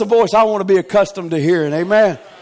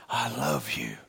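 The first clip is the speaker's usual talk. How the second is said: soft, low and tender; a hushed loving I love you; 15 dB quieter than usual. Retold or imitated. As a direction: say it softly like a spouse. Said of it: "husband, wife says".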